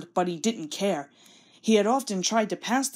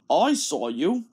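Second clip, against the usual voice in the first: lower, stern boss voice; stern Fat Controller; 4 semitones lower, slower than usual. Acted. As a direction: tick off the naughty engine. Fat Controller scolds Thomas.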